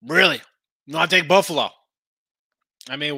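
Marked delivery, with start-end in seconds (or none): 0.1-1.7 incredulous. Real, not acted. Scoffing at Dolphins plus ten.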